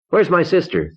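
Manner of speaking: high, urgent yell. Acted. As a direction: shout it up at him in alarm. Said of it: "I yelled".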